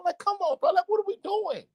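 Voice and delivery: high, pleading come on, bro; exasperated disbelief. Real, not acted. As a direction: throw your hands up at the quarterback. Ranting about quarterback's fourth-down throwaway.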